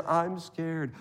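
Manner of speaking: put-on small, soft scared-child voice; little Johnny. Child tells woken parents about a fear, small soft voice. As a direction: imitate a timid child, hushed. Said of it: "little Johnny says".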